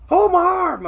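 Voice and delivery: loud, high cry to hold the arm; retold screaming plea. Begging the paramedics to hold his arm, loud, high and pained. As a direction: beg them through the pain. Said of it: "I get to screaming".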